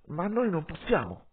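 sudden emphatic objection; impulsive outburst. A narrator's reading. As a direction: blurt it out, unable to hold back. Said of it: "Avevo parlato di slancio, ormai incapace di trattenermi".

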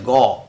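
"गॉ" cut off after its open O vowel; vowel sound demo. Shown as a demonstration. Teacher shows learners the vowel in God, sounded out. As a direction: say "गॉ" without the final D. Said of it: "ओ का उच्चारण होता है".

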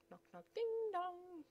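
sing-song high "ding dong" like a chime; a cheery voiced doorbell; spoken doorbell. Spoken sound effects at door.

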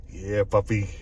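deep mock tough-guy catchphrase; low catchphrase voice.

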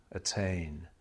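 ordinary delivery

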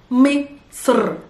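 Misr slowly in parts, with a rolled r; Arabic Misr. Shown as a demonstration. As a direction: draw out Misr, heavy s and trilled r. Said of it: "We completely transform it into Misr".

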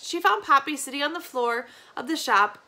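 ordinary delivery, nothing marked

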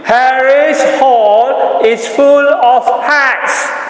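loud, high and slow; loudly. Shown as a demonstration. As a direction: call it out big to the class.

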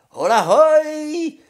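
very high, loud, drawn-out halloo; calling after someone. A narrator's reading. Honza calls after his brothers, high long holler. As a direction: halloo to riders far ahead. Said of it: "volal za nimi hloupý Honza".

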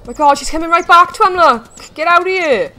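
high, loud cry of alarm; alarmed yelling. Real, not acted. Player seeing the cougar come right back, alarmed. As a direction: shout to drive the attacker away.

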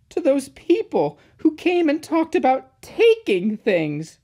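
high, loud anxious explanation; upset little engine. A narrator's reading. Upset engine explains his fear.

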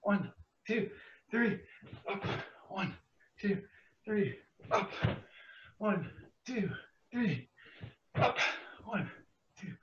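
breathless, quiet counting; running out of air. Real, not acted. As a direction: count while gasping for air. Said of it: "I'm running out of breath".

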